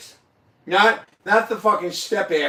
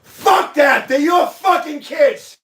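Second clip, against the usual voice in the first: loud, higher, slow angry shout; real shout; 8 semitones higher, 4 dB louder, much slower than usual. Real, not acted. Friend shouting that the kids are his. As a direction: yell at him not to give up.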